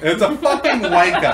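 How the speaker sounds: a laughing echo of the British curse; mock British. Friend echoes the joke insult in mock British, laughing.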